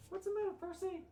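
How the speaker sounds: pet talk. Real, not acted. Soothing her crying cat in a high coo.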